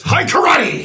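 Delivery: shouted martial-arts yell; hammed-up karate shout; mock battle cry. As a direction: belt it like a cheesy karate kid. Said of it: "your battle cry is".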